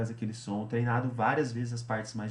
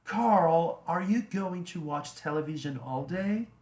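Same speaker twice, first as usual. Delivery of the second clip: higher, slower, copying the native speaker's tune; copying the recording's intonation; 5 semitones higher, slower than usual. Shown as a demonstration. Teacher shadows the recording, slow and higher. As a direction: shadow the audio line with its melody. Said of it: "Mantendo a entonação".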